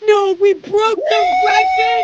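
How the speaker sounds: high, loud cry of disbelief; stunned shout. Real, not acted. Streamer learns chat broke the record, stunned shout. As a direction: shout it out, pitch climbing.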